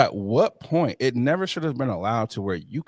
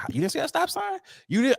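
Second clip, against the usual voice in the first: incredulous; 14 semitones higher, faster than usual. Retold or imitated. Mocking someone missing stop sign.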